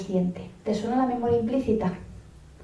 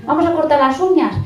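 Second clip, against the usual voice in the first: higher, louder "uñas" said plainly without the diminutive; coaxing toddler, standard word; 6 semitones higher, 5 dB louder than usual. Retold or imitated. Parent correcting the regional word to a toddler, higher and louder. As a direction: coax with "uñas" instead of "uñicas". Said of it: "Lo de uñicas".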